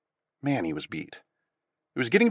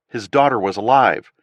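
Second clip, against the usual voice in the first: ordinary delivery, nothing marked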